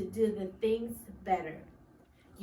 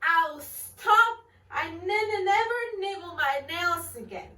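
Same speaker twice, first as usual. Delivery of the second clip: nervous stutter; 6 semitones higher, 4 dB louder than usual. Acted. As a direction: promise with a stammer. Nikki stuttering promise.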